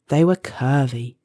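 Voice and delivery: ordinary delivery, nothing marked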